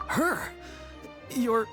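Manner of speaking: high, startled outburst; shocked disbelief. Acted. Troupe member reacting to a shocking suggestion, incredulous. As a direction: pitch shoots up, then breaks off.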